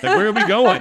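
high, loud alarmed question; mock alarm. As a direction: act out rising panic for laughs. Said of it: "then you get more alarmed".

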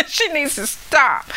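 laughing through the words; teasing her ornery grandmother through laughter; laughing tease. Real, not acted. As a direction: giggle as you tease her.

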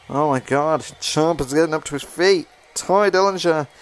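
usual speaking voice

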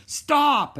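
high, loud stop!; shouted. A narrator's reading. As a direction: staff shouting after a runaway boy. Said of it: "he heard shouts of".

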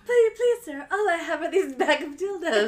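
high, loud pleading whimper through laughter; mock begging victim. She plays the robbed victim begging the mugger, whiny high plea. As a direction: beg the robber in a whiny squeak.

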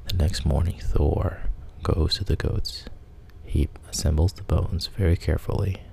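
plain reading voice

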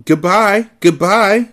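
to the dock crowd, cheerful call; calling goodbye. A narrator's reading. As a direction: wave off strangers from the railing. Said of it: "we called".

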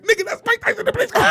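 high, fast, hushed excitement; excited disbelief. Retold or imitated. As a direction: rattle it off, thrilled and amazed. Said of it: "Me and Spank like".

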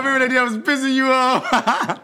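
laughing through the words; giggling as he asks. Real, not acted. Laughing while recalling the prank.

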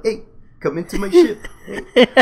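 light Kiwi lilt for a beefed-up bouncer; soft New Zealand bouncer. Acted. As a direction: imitate a gentle-voiced New Zealand bouncer. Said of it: "sound like that".

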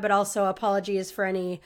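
usual speaking voice

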